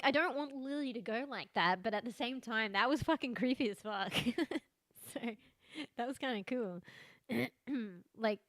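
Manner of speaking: usual speaking voice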